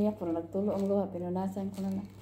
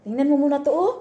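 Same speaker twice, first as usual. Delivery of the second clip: louder and higher, insistent; loud urging; 7 semitones higher, 11 dB louder than usual. Real, not acted. Showing Risa the video, loud and insistent. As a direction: get her to come and look.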